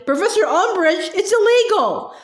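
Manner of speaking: high, urgent shriek. A narrator's reading. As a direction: shriek it out in alarm. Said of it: "shrieked Hermione".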